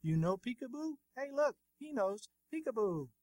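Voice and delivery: narrator's light imitation of a man shouting over; mock shouting across room. A narrator's reading. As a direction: call out playfully to a baby, pitch lifted. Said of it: "began shouting from across the room".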